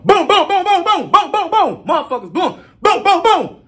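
quick high booms mimicking gunfire, half laughing; imitated gunshots. Telling how they started blowing, mimics gunshots. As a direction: pop out each boom like a shot going off.

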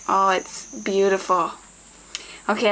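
normal talking voice

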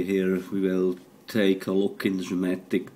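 ordinary talk, nothing marked

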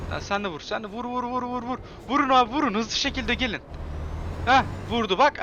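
high, loud cheering for the crash; excited cheering. Real, not acted. (tiz sesle coşkuyla tezahürat)

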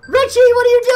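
high, loud frantic shouts; panicked yelling. Real, not acted. Gamer yelling at teammates to vote, high frantic shouting. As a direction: yell at the team to hurry and vote.

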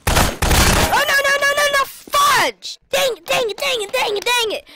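high, loud repeated dang it, falling; cursing the near miss; frustrated shouting. Real, not acted. Gamer cursing loudly after getting caught. Caught just before safety.

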